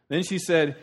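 ordinary talk, nothing marked

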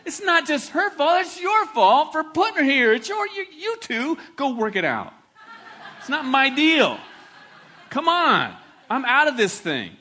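blame-shifting Adam. Acted. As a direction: play Adam passing the buck, half laughing. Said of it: "He says".

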